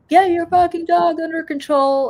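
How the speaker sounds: mock furious shout, pitched high and loud; imitating an angry yell. Imitating Tony Danza yelling at her dog, furious. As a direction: bark it like a man losing his temper. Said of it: "Tony Danza went a little nuts".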